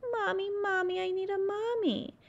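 high, lightly sobbing little bird; a small bird calling for mommy through mock tears; crying. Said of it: "he started to cry".

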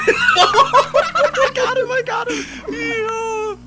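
high, slow, laughing yell of triumph; excited shout. Real, not acted. Gamer yelling at his kill in triumph. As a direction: cry out as the shot lands. Shooting a player in game.

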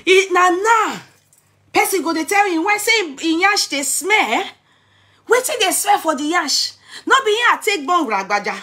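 loud, high, exasperated ranting; incredulous outrage. Real, not acted. Speaker rants to sister D, loud and high in disbelief.

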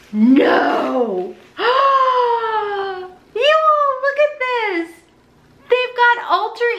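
high, loud gasping delight; excited squeal. Real, not acted. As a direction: squeal with real excitement at the find. Unboxing, sees a coveted palette.

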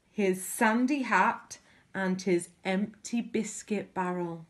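usual speaking voice

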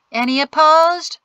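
loud, high shouted question. A narrator's reading. Whipple shouting to the crowd, loud. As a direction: call it across the hall. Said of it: "shouted Whipple".

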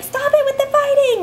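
high-pitched mock mom yell; retold yell. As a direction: imitate an exasperated parent yelling, voice shooting up. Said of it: "then I yell at them".

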